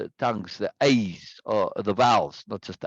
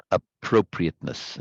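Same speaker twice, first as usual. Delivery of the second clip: "appropriateness" with a big PRO; ap-PRO-priateness, exaggerated for teaching; overemphasized second syllable; 3 semitones lower than usual. Shown as a demonstration. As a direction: lean hard on PRO in appropriateness. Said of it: "overemphasizing it".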